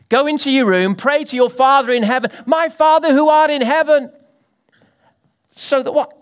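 high, loud proclaimed prayer; fervent preaching. Acted. Preacher to congregation, fervent high proclamation. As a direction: cry it out to heaven.

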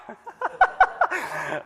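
a bright burst of real laughter; high laugh. Real, not acted. Preacher to congregation, laughs at himself. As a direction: laugh at his own lazy student self.

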